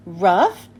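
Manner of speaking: one loud, outraged word; indignant shout. A narrator's reading. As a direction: shout it back in disbelief. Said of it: "Peppermint Patty shouts".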